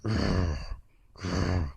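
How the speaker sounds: rolling dog growl; two short, forceful growls; imitated growling. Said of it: "then it started growling".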